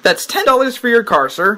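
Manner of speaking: usual speaking voice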